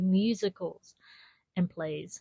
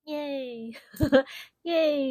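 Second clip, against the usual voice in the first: cheerful yay; 6 semitones higher than usual. Real, not acted. Cheering the journey.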